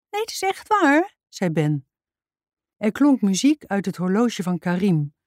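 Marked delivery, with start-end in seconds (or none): 0.1-1.2 put-on very high, earnest child voice; high-pitched insisting. A narrator's reading. Ben insisting his tadpole claim is true, high-pitched protest.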